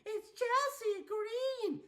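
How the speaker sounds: very high, drawn-out announcement; over-the-top high-pitched introduction; mock announcer reveal. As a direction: squeal out the surprise entrant slowly. Acts out big surprise announcement.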